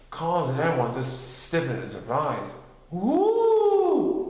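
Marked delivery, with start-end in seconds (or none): 2.9-4.3 loud high woo; high ghostly whoop. A narrator's reading. Spooky moan in the dark, high and loud. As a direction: howl it like a ghost.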